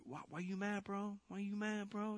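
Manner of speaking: high, soft sing-song teasing; mock taunting. Preacher needles Tom Brady, "you mad, bro", mock taunting.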